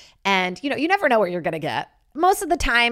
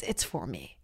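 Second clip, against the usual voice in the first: much lower and softer, murmured to herself; quiet aside; 12 semitones lower, 12 dB quieter than usual. Shown as a demonstration. Comic admitting the show is for herself, low soft murmur. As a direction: drop it to a low private murmur. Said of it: "repeated, second take hushed".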